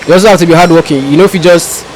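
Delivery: usual speaking voice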